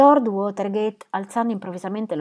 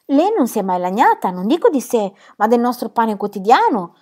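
mock dismay; 3 semitones higher, 7 dB louder than usual. Phyllis teasing Gertrude with put-on, loud dismay. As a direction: play at being shocked. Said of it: "con uno sgomento un po' artificioso".

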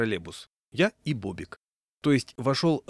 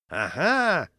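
higher, louder triumphant shout; 7 semitones higher, 5 dB louder than usual. A narrator's reading. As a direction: triumphierender Ruf. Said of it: "закричал".

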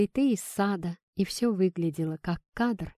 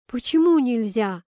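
high, loud, puzzled question; raised-voice child's bewilderment; sincerely surprised; 5 semitones higher, 7 dB louder than usual. A narrator's reading. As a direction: ask it in innocent surprise. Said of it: "искренне удивилась Кира".

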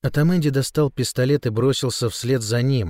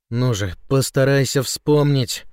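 usual speaking voice

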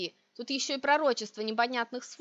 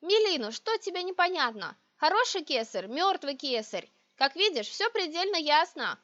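higher-pitched, exasperated explaining; irritated; 6 semitones higher than usual. A narrator's reading. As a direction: spell out the obvious with irritation. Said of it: "Раздражённо вопрошаю".

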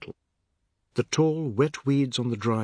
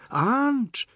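high ringing shout of aunt; boy shouting for his aunt; 13 semitones higher, 4 dB louder than usual. A narrator's reading. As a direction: call out loud through the dark house. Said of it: "Anand shouted".

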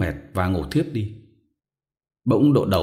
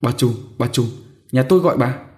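louder, hurried call; flustered calling; 5 semitones higher, 4 dB louder than usual. Acted. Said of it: "cuống quýt nhổm dậy gọi". (gọi cuống quýt, to tiếng)

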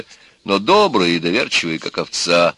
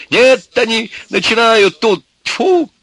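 high, slow, loud despairing yell; senseless shouting; 6 dB louder, slower than usual. A narrator's reading. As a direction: cry out in helpless outrage. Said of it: "бессмысленно кричал он оттуда". (отчаянный протяжный крик)

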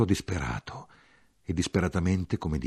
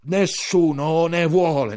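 shouted despair; 10 semitones higher, 8 dB louder, slower than usual. Acted. Ultime parole gridate dietro.

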